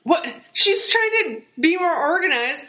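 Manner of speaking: high-pitched baffled outburst; incredulous. Acted. Host objecting to the preacher's remark, incredulous. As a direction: react in disbelief, pitch jumping up.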